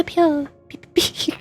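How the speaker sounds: ordinary talk, nothing marked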